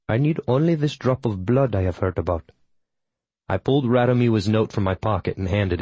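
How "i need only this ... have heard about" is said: put-on calm Tamil accent; matter-of-fact, Tamil accent. A narrator's reading. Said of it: "in her Tamil accent".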